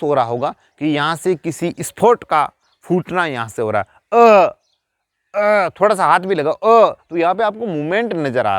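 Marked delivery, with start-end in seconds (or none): 4.1-4.5 अ voiced loud and open from the throat; sounding the vowel. Shown as a demonstration. Teacher sounds अ from the throat for the class. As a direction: sound out अ clearly from the throat for students. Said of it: "आप खुद बोल करके कोशिश कीजिए अ".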